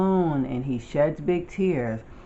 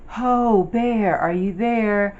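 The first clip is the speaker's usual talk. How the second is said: high, raised searching call; 6 semitones higher, 4 dB louder than usual. A narrator's reading. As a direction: call out for a lost friend. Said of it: "They call".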